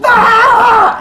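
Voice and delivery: loud high-pitched scream; pained scream. Acted. Boy blinded by the light, screaming. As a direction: cry out at full pitch, acted. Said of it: "starts screaming".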